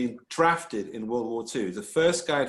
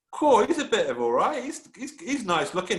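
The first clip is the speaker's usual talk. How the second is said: lightly put-on British accent, pitched higher; 7 semitones higher than usual. Historian voices wife nudging her girlfriend, mock British accent. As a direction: imitate an admiring woman in a British accent. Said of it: "That's my British accent there".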